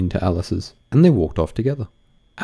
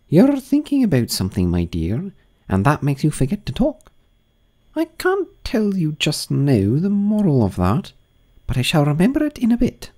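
high grand duchess voice; imperious plummy grande dame; 10 semitones higher than usual. Acted. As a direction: purr like a grand old duchess.

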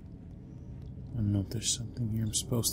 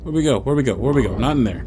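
loud frantic questions; panicked; 9 dB louder, much faster than usual. Real, not acted. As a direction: panic as you're dragged off. Being carried by monster.

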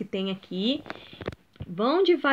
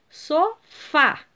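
"Sofá" in two separate syllables, "fá" stronger; stress on last syllable; 3 semitones higher than usual. Shown as a demonstration. Teacher showing third graders the tonic syllable, stressing "fá" in "Sofá". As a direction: split "Sofá" and punch the final "fá". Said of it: "O fá é a última sílaba tônica".

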